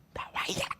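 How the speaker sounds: whispered shout, very soft; mock yell. Instructor ordering the soap thief out, hushed mock yell. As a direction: imitate yelling with almost no voice. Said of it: "막 소리를 막 치는 거예요".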